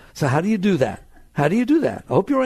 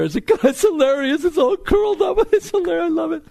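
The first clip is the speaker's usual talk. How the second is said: high, gleeful laughing voice; tickled pink, voice soaring; laughing delight; 12 semitones higher, 3 dB louder than usual. Acted. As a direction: crack up and love it, pitched way up. Models laughing delight at curly hair.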